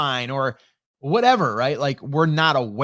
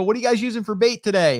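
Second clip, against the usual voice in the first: high, fast, upbeat call; asking eagerly from up the yard; friendly call; 7 semitones higher, faster than usual. Retold or imitated. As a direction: neighbour calling a question down to the kids fishing. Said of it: "And I go".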